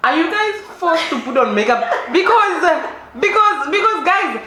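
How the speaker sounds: high, laughing protest; indignant laughter at the absurdity; laughing outrage. Real, not acted. Host reacts to forced makeup, high laughing outrage.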